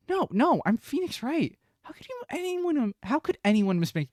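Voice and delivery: high, squeaky indignant denial; flustered protest. Acted. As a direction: leap up high and loud, spluttering in mock outrage. Acted protest at mistaken identity.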